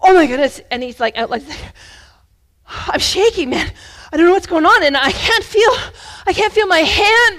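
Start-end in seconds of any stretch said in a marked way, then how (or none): 0.0-0.6 loud acted alarm; imitated panic, much louder; panicked exclamation. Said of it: "his breath was laboring". 2.7-7.4 high, loud, frantic imitation; acted panic attack. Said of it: "his breath was laboring".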